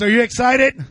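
ordinary delivery

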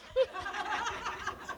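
quiet, high-pitched laugh; soft high laugh. Real, not acted. Laughing at her own cry to the ladies, soft and high.

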